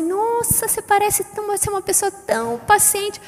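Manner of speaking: high, soft gushing praise; gushing admiration. Acted. Admirer praising someone's patience, gushing. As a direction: coo with mock amazement, high-pitched.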